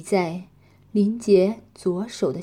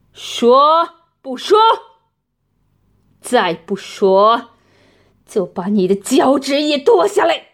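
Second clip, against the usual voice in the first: harsh demand; 3 semitones higher, 8 dB louder than usual. Acted. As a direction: snap the threat out sharply. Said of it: "厉声问道".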